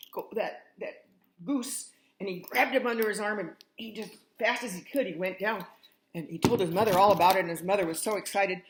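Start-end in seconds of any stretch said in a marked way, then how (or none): none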